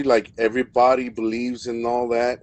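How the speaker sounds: ordinary talk, nothing marked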